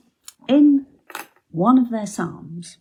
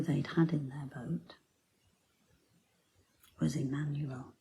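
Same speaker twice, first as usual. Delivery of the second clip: very soft, lower reverent voice; hushed preaching; 3 semitones lower, 10 dB quieter than usual. Real, not acted. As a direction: let it sink in, almost whispered. Preacher drops to hushed reflection.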